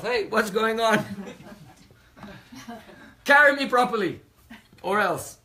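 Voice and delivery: loud, high outraged king yelling from the palanquin; angry royal shout. Acted. King yelling at his palanquin bearers, loud and outraged. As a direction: bellow at the bearers to carry him properly.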